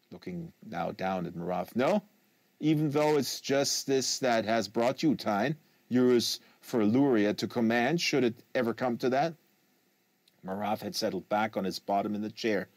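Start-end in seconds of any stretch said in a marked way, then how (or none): 1.8-9.3 put-on measured French Canadian accent, lightly done; a Quebecois agent speaking careful foreign English. Quebecois agent correcting the American, calm French Canadian accent.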